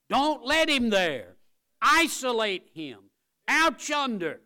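high, loud fiery preaching; preaching at full force. Acted. Preacher warning the church against the devil, high and loud. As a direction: thunder it at the congregation.

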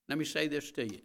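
ordinary talk

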